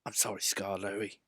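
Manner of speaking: put-on a gentle engine apologising quietly to the old engine; soft apology. A narrator's reading. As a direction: say sorry under your breath. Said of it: "he whispered".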